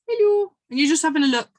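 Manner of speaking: high, loud sing-song hello; cheery high hello; playful hello. Pretend. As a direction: greet them like a surprise. Greeting your past self playfully.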